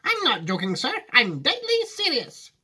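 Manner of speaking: high, earnest Wonka voice; serious Wonka. Acted. Wonka assuring Grandpa Joe, high and earnest. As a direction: insist gravely.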